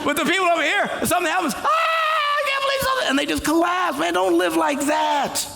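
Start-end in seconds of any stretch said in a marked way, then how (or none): 1.5-3.1 high, shrill-free mock despair cry; hammed-up cry of despair; mock panicked wail. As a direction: wail it like someone falling apart. Mocking people who collapse in panic.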